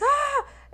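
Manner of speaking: high, loud, slow drawn-out scream; imitating her own scream; retold scream. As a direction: let out a long scared yell. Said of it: "قعدت أصارخ".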